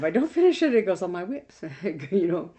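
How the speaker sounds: laughing while talking fast. Real, not acted. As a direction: giggle through the words. Laughing about unfinished goals.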